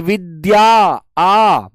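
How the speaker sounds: विद्या with its final आ held long and open; open-mouth aa. Shown as a demonstration. Teacher showing students how the final आ sounds. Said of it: "मुंह खुल रहा है आपका बोलने से?".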